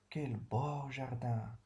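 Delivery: slow, drawn-out admiration; slow, wondering praise; admiring. Shown as a demonstration. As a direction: linger over it as if you see the garden. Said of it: "un sentiment d'admiration".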